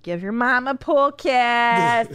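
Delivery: clingy mom. Pretend. To her grown kids at forty, slow doting mom plea. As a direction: drag it out lovingly to grown sons. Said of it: "I'll be like".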